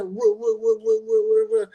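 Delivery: high mock whooping; lightly voiced woo-woo of a guy charging at him; imitated hollering. Man trying to get through the crowd to fight, mock whooping.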